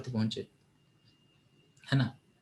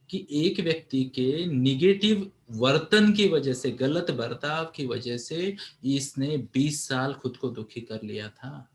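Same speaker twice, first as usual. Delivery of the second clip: usual speaking voice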